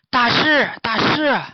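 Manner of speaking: high, loud urgent call. Acted. Pig calling the sleeping master, loud and urgent. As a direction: wake him up.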